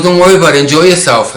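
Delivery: loud, high, emphatic friend voice; retold hearty send-off. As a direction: send a friend off happily, imitating him.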